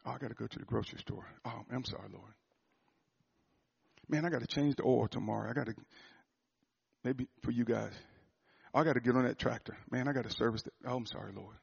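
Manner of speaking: soft, fast muttered to-do list; mumbled distractions. Retold or imitated. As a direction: mutter the errands under your breath.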